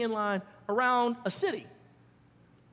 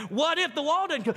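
ordinary talk

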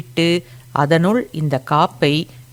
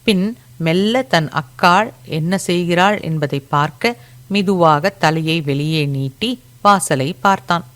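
plain reading voice

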